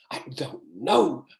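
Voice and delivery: lightly voiced flustered character, drawn out; slow, exasperated quote with a stretched last word; overwhelmed Telly. Writer voicing overwhelmed Telly under a flood of questions, flustered. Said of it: "and he's going".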